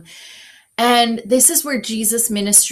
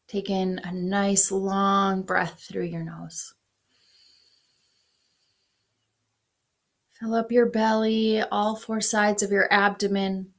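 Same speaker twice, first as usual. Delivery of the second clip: guided meditation; 3 dB quieter than usual. Acted. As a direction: ease them into breathing. Leads a breath prayer.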